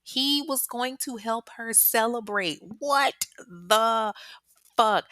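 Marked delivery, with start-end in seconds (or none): 2.8-5.0 shocked, higher and louder outburst; outraged what the fuck. Real, not acted. Narrator blurts out shocked disbelief. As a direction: react in disbelief to his plans.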